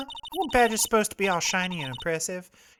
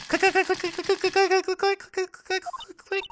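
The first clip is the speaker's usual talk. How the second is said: clicking noises; 3 dB louder than usual. Acted. Mimicking a camera clicking.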